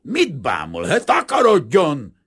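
very loud, high dismissal; snarling anger. A narrator's reading. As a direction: lash out and chase them off. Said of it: "Kazimír rávicsorgott".

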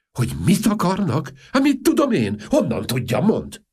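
high, loud flustered retort; frantic exasperation. Acted. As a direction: snap back in a panic. King flustered by question.

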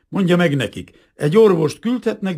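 high, loud emphatic instruction; insistent order. A narrator's reading. Captain allows only a doctor, insistent. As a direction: insist forcefully through the interpreter.

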